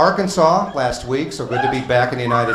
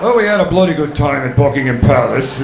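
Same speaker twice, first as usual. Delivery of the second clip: hammed-up British accent; a mild fake posh British accent; mock British accent. American back from England doing mock British accent. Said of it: "sounding like one of the Beatles".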